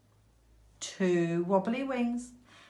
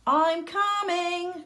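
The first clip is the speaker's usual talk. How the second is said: very high, slow, desperate cry; 12 semitones higher, 4 dB louder, slower than usual. A narrator's reading. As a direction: cry out after the leaving flock. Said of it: "cried the ugly duckling".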